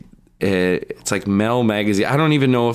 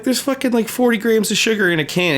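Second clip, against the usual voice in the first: exasperated retort; 11 semitones higher than usual. Retold or imitated. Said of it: "I'm like".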